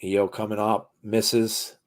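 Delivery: excited live ring call; wrestling commentator. Acted. Live play-by-play of the match.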